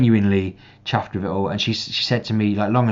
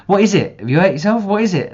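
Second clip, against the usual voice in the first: much higher and louder, pressing questions; insistent little sister; 12 semitones higher, 8 dB louder than usual. Retold or imitated. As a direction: mimic a girl demanding an answer from her brother. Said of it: "And she's like".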